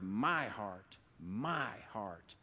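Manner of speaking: "my" stretched out and leaned on; stress on MY. Shown as a demonstration. Preacher pointing out the middle word, stress on "my". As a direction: linger on "my" before "heart". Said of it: "put the emphasis on the middle word".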